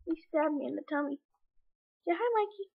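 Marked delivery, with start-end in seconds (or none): 2.1-2.7 high sweet voice to a cat; pet talk. Real, not acted. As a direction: coo at your pet. Talking to the cat.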